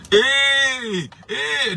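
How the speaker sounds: high screaming hey hey. Retold or imitated. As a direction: shriek it like a scandalised congregation. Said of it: "they were screaming".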